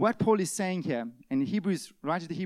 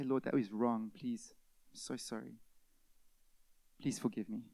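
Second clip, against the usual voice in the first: soft prayer; 5 semitones lower, 7 dB quieter than usual. Pretend. Voicing a prayer of confession.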